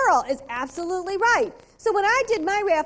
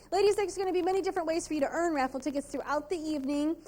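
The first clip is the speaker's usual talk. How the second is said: ordinary talk, nothing marked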